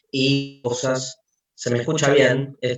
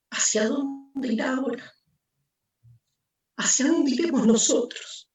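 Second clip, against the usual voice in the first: anguished madman; 7 semitones higher than usual. Acted. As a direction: ask it as if the ground is gone. Madman's rising questions.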